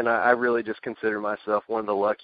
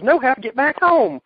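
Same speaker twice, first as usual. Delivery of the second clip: high, loud exasperated impression; Cornette impression; 8 dB louder than usual. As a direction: squawk it like a cranky manager. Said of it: "He goes".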